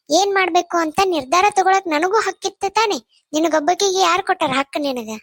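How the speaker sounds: heated, indignant accusation; angry quarrel. Acted. Mother accusing elder sister over her son's marriage, angry.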